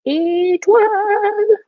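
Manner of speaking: "Étoile" sung out high, last syllable held long; high drawn-out call. Shown as a demonstration. Teacher leading children's repetition of star, high drawn-out call.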